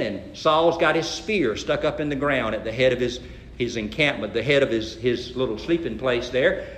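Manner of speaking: don't wake the camp; hushed. Retold or imitated. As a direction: drop low and soft as if sneaking past sleepers. Sneaking into the sleeping camp.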